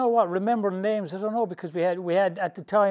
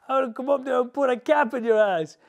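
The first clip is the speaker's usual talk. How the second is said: higher, louder, hammed-up threat; lightly voiced tough guy menacing; mock threat; 9 semitones higher, 6 dB louder than usual. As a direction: caller threatens over the phone, hammed-up and louder. [name] voiced making a threat.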